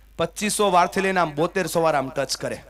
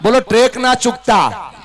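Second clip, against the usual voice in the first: raised, higher crowd-leading call; louder rallying call; 5 semitones higher, 4 dB louder than usual. Shown as a demonstration. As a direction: lead the audience to chant it louder. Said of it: "થોડું જોરથી બોલો".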